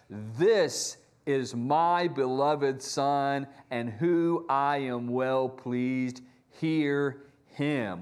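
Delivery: low, slow, solemn voice from above; voice of God from heaven. Acted. God speaking from the cloud to the disciples, low and slow. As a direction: boom it down slowly like God from the clouds. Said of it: "the voice from heaven that says".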